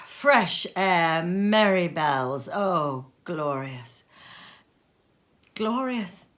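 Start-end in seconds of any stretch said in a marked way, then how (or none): none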